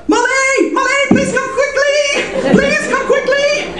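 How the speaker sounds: high-pitched frantic calls for help, drawn out slowly; panicked screaming. Retold or imitated. A frightened student calling the host mom to come quickly, a high, slow panicked yell. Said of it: "suddenly I hear him screaming".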